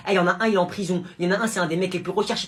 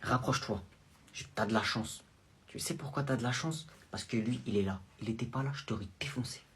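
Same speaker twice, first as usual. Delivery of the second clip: low, much softer menacing mutter; hushed threat; 7 semitones lower, 11 dB quieter than usual. Acted. Mother threatening her son quietly in front of the guard. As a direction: threaten him under your breath so the guard won't hear.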